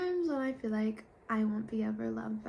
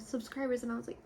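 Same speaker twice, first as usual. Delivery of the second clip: ordinary delivery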